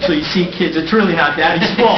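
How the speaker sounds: loud, laughing tease. Real, not acted. Mayor teasing his kids from the dais, loud and laughing.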